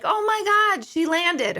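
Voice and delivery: high, thrilled exclamation; re-enacted burst of excitement; retold excited news. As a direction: leap up in pitch with amazement. Voices excited news from dead mother.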